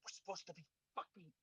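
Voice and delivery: loud, high, strained whisper-shout; whisper yelling. Pretend. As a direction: scold the team in a whisper-shout. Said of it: "you know that whisper shout thing".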